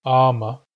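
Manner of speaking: ama called out loud for the class; loud demo word. Shown as a demonstration. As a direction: say ama boldly and clearly. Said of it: "is a loon".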